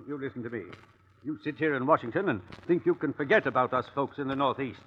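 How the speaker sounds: low, quiet threat; a low warning to the President; low menace. Acted. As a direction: lean in and threaten under your breath.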